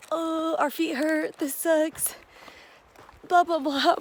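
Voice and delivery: high, drawn-out imitated complaint; mock whining. Two hikers griping at a water stop, mock whining. Acted shared complaint about sore feet.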